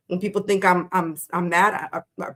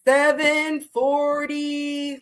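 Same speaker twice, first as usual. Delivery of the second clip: very slow, stretched words, slightly higher; drawn-out deciding; 4 semitones higher, much slower than usual. Real, not acted. Host picking the reading time for Aries, drawn-out deciding. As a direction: stretch each word while making up your mind. Drawing out the timer setting.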